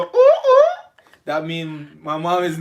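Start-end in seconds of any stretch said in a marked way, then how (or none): 0.0-0.6 high, loud hooting call; loud high ooh calls; signal call ooh. Retold or imitated. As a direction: hoot it out like a signal to friends. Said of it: "they hear me go".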